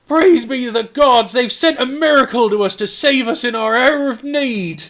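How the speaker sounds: high, loud, slowing cry of praise; awestruck mayor. Acted. Mayor hails the hero sent by the gods, awestruck cry. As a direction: proclaim a miracle, wide-eyed and loud. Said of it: "his eyes go wide. And he says".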